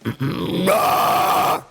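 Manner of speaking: loud strained rasp; overdone scream. Shown as a demonstration. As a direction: push way too hard. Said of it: "If you're getting a sound like".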